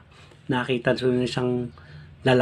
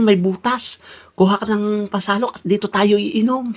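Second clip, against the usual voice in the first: excited find; 9 semitones higher than usual. Acted. Mangyan excitedly finds spring.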